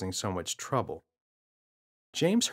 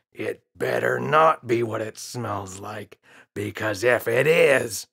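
slow, a touch louder warning; a hint of a menacing dad; threatening father; 6 dB louder, slower than usual.

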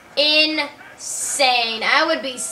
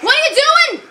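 shocked shout; 13 semitones higher, 6 dB louder than usual. Real, not acted. Shocked at teammate.

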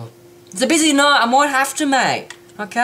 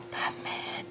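gasped, voiceless whisper; hushed breath of acted wonder; awestruck; 18 dB quieter than usual. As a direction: gasp and whisper it in mock reverence. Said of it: "with this one, I'm just like".

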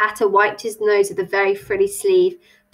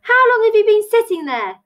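high, loud question; shouted; 12 semitones higher, 6 dB louder than usual. A narrator's reading. Alice questions the stranger over the noise, shouting. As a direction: shout it down the table. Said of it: "shouted Alice".

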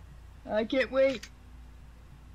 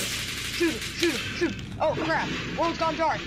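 panicked; 7 semitones higher than usual. Acted. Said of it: "Horrible voice acting".

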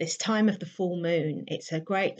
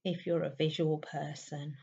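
guided meditation; 4 semitones higher than usual. Real, not acted. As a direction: gently guide them inward, leaving space between lines.

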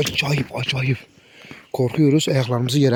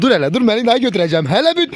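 fast and higher, rising to a yelp; excited call; 7 semitones higher, faster than usual. Real, not acted. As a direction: hurry to stop the dog. Stopping the dog grabbing a bone. (telaşla, hızlı ve ince sesle durdurma)

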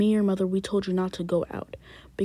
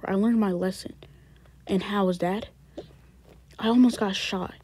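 ordinary delivery

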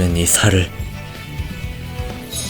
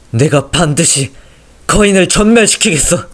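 fierce vow; 8 semitones higher, 4 dB louder than usual. Acted. Vow to dying soldier.

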